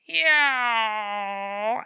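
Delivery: loud held yowl; a long threatening yowl; cat yowl. A narrator's reading. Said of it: "his voice deepening as the others rose".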